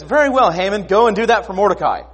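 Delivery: high, loud royal pronouncement; mock-regal proclamation; commanding king. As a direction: hand down the order grandly. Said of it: "the king says".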